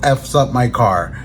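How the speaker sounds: normal talking voice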